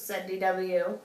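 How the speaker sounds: usual speaking voice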